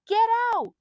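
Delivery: high, loud urgent shout; boy crying out to friends; frantic cry. A narrator's reading. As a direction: scream for them to move. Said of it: "Chet cried".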